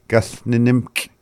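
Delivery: "Gus Ninimk" with a drawn-out Gus and the first m dropped; reduced first nim. Shown as a demonstration. Teacher shows the rapid-speech form, first nim reduced. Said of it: "It sounds like n".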